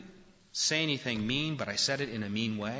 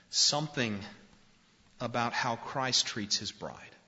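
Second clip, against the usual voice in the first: ordinary talk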